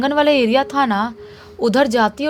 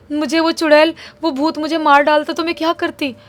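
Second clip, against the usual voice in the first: upset and scared; 5 semitones higher, 4 dB louder than usual. Retold or imitated. Frightened girl complaining to brother.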